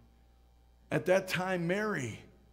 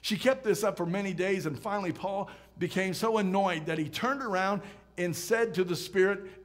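ordinary talk, nothing marked

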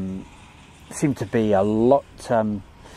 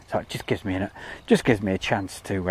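usual speaking voice

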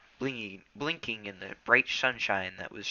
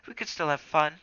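higher, louder pleading cat friend; Smudge the cat, protesting; 8 semitones higher, 5 dB louder than usual. Acted. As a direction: argue your friend round, pitched up.

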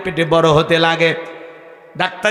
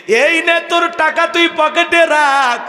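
hurt mother's reproach; 9 semitones higher than usual. Acted. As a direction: push the money back, heartbroken. Mother hands back the money.

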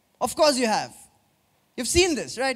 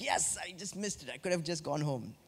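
quick, much softer mock regret; mock churchgoer; 6 semitones lower, 15 dB quieter, faster than usual. Preacher mimics a churchgoer who'd rather leave, soft and quick. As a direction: tease the listener who wanted to go home. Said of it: "Some of you are like".

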